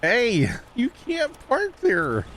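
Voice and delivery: high, drawn-out "hey" falling away; mock scolding call-out. Player ribbing a teammate over bad parking, mock scolding. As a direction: call it out slowly, in mock reproach.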